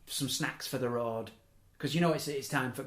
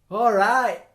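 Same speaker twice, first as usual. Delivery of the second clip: loud, higher-pitched "oh, all right"; loud retold reply; 8 semitones higher, 12 dB louder than usual. Friends caught with a girl's champagne, loud retold reply. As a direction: call it out high and loud.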